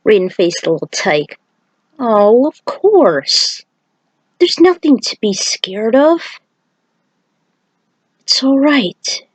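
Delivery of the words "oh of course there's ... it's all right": slow, gentle comfort; reassuring. Acted. Raiden calming a frightened boy, slow and gentle. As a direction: soothe a scared child slowly.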